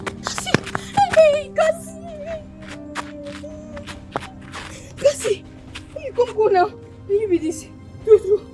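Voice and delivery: high-pitched excited call; high, lively hailing. Acted. Calling out to Cassie.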